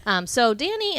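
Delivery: usual speaking voice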